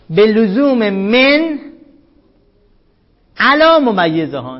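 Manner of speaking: slow, loud recitation of the Arabic; deliberate textbook reading; reading the text aloud. Shown as a demonstration. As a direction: read each word out slowly and firmly.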